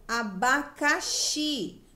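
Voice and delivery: "Abacaxi" very slowly, syllable by syllable, XI held; stressed syllable demo. Shown as a demonstration. Teacher asks which syllable is strongest, stressing XI in "Abacaxi". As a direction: spell out "Abacaxi" in syllables, leaning on the final XI. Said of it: "Qual que eu falei mais forte?".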